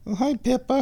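high, cooing hello to a cat; high-pitched kitty greeting; pet talk. Real, not acted.

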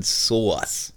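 SAUCE stretched out for comic effect; drawn-out pronunciation. Pretend. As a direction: linger playfully on the word sauce. Comic drawn-out word, repeated after correction.

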